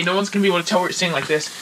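normal talking voice

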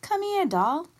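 high-pitched beckoning call; older woman calling. A narrator's reading. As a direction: beckon her over in a high voice. Bea beckons the narrator forward.

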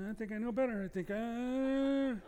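high, slow, doubtful musing; second-guess the GPS aloud; drawn-out high uncertain thinking; hesitant inner voice. Retold or imitated. Voiced thoughts at a turn.

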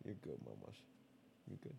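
very soft, tender reassurance; soothing pet talk. Real, not acted. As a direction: hush the pet gently. Soothing the cat.

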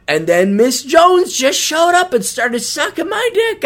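mock innocent guy. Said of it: "Don't tell me".

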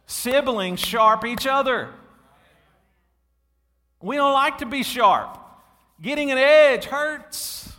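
high, loud preaching build; preaching at full force. Real, not acted. Pastor preaching hard to the congregation, loud and high. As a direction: drive it home to the church, full voice.

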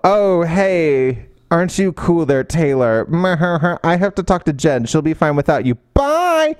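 dismissive fake sweetness ending in a high bye; mock snide ex. Narrator mocks the cruel ex Kelly, snide and sing-song.

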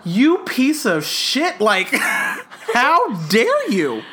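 slow, drawn-out mock insult; mock outrage. Mock outrage at a friend who ignored advice. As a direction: pretend to be furious at a friend, half laughing.

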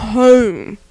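exaggerated regional O in "home"; Delaware accent. Shown as a demonstration. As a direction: let the O in "home" dip. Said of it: "but we say".

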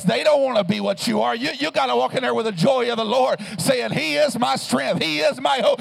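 loud, fervent sermon cadence; full-throated revival preaching; preaching at full force. Acted. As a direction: lift the room with it.

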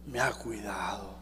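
hushed, reverent whisper of devotion. Retold or imitated. Old martyr whispers why he cannot deny Christ, in a hush. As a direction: breathe it out as a secret faith. Martyr's quiet confession of faith.

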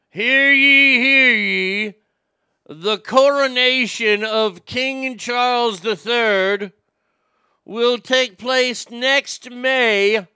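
loud, slow royal proclamation; town crier. Acted. Royal announcement, calling out.

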